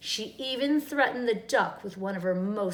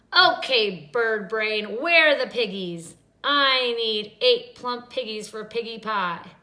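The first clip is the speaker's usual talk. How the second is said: bossy witch; 7 dB louder than usual. A narrator's reading. Witch demands of chicken.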